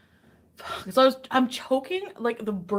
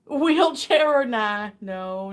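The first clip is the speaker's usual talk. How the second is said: normal talking voice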